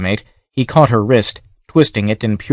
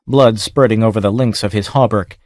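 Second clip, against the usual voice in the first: ordinary delivery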